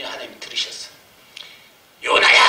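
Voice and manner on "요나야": loud commanding call of a name; booming call. Retold or imitated. God calling the pastor's name from above, a booming call. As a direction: ring it out like a voice from heaven.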